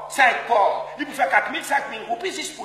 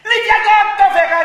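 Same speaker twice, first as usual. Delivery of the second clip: shouted warning, much higher and louder; loud call; 9 semitones higher, 11 dB louder than usual. Acted. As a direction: yell to the guys. (avertissement crié)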